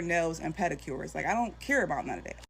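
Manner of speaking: normal talking voice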